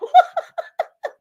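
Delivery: high shrieking laugh. Real, not acted. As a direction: howl with laughter. Laughing at her trouble.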